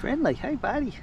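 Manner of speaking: sweet talk the friendly kitty; pet talk. Real, not acted. Talking to the cat.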